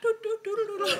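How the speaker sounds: high-pitched "doo-doo-doo"; comic high riding sound; imitated riding noise. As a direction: sing out tiny high doos. Imitates herself riding a little bike.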